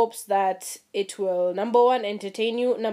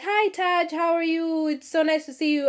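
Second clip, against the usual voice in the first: mock sweet greeting; 7 semitones higher than usual. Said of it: "He was like".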